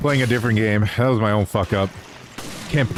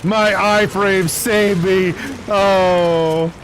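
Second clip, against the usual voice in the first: excited; 3 semitones higher than usual. Real, not acted. Surviving explosion in game.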